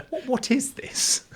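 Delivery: high, slow, puzzled mock royal; a light nod to the Queen, puzzled; slight Queen impression. Recalling the Queen at the ceremony, lightly imitated puzzled voice. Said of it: "I won't do an impression".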